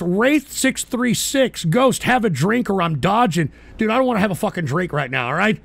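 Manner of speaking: usual speaking voice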